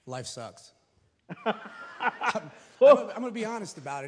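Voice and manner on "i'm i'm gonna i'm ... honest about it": nervous laughing. Real, not acted. Nervous start of testimony.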